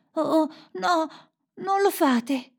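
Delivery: put-on high-pitched pleading little girl; girl crying out. A narrator's reading. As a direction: cry out in a high child's voice. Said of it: "gridò la figlia del bandito".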